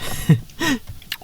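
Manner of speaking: high breathy giggle. Real, not acted. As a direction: burst into a giggle. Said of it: "I can't stop laughing".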